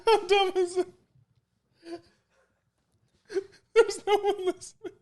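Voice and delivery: high, squealing real laughter through the words; laughing to tears. Real, not acted. Host mocking their own show to friends, laughing till crying. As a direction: lose it completely, voice shooting up with laughter.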